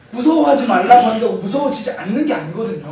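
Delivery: ordinary delivery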